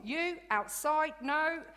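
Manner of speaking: high, loud, slow and bouncy scolding; happy teacher scolding with a smile; cheerful telling-off. Retold or imitated. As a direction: tell them off while beaming. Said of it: "but in a really cheerful mood".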